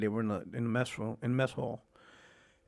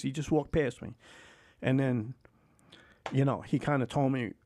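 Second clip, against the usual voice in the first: normal talking voice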